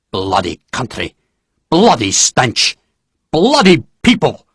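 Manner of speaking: rant, each curse hotter; Nairn grumbling. A narrator's reading. General rants about Spain, building angrily.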